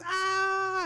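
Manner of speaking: loud, high excited scream; imitated child's squeal. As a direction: shriek like a toddler running to dad. Child squeals running to dad.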